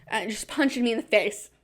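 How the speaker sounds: ordinary delivery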